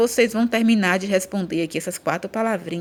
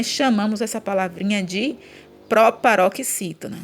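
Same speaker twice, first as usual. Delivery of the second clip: usual speaking voice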